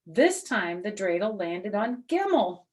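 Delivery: ordinary delivery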